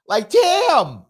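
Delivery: high, loud astonished exclamation; stunned damn. Real, not acted. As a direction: gasp it out in disbelief. Reacting to Trump's threats.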